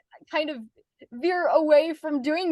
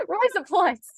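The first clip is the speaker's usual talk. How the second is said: higher, amused Aussie "Rise up lights"; Australian accent; 8 semitones higher, slower than usual. Shown as a demonstration. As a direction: say "Rise up lights" like Australian "razor blades", pitched up. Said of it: "if you wanna say razor blades".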